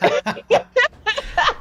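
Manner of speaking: high burst of giggles; real laugh. Real, not acted. Coach laughing about a challenge not to be repeated, high giggle.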